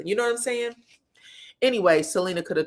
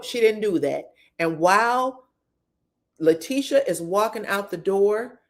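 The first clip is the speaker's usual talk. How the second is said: ordinary talk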